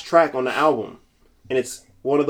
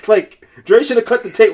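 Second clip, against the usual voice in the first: ordinary talk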